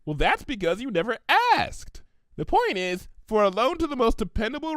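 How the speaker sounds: high, bubbly pony voice; Pinkie Pie voice. Acted. Pinkie offering Applejack the money, high and eager. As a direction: chirp it out eager and helpful.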